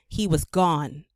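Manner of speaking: ordinary delivery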